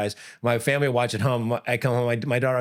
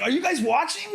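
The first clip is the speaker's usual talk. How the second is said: high, incredulous demand; exasperated appeal. Retold or imitated. Coach appealing to the judges, exasperated disbelief. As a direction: plead with them in pitched-up disbelief. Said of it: "Like, to the judges".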